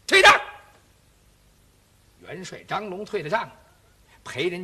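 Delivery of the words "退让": loud, higher command to withdraw; loud dismissal. Acted. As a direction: call out the dismissal sharply. 元帅高喝退堂. (元帅高声喝令退下)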